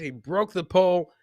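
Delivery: ordinary delivery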